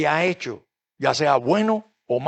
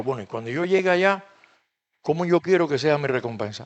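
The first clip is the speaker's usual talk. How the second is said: "Bueno, y cuando yo llegue allá" said softer and faster, musing to oneself; quiet inner thought; 4 semitones lower, 7 dB quieter, faster than usual. Retold or imitated. Preacher voices the believer's private wondering, quietly. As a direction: think it aloud under your breath. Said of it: "Tienes que pensar".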